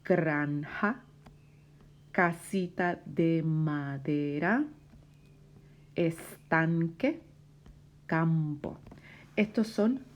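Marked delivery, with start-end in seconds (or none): 0.0-8.8 slow words, lifted ends until the last falls; word-list read-aloud. Shown as a demonstration. Teacher reads second set of words, slow model reading. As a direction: read out the list, pausing after each item. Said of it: "Otra vez".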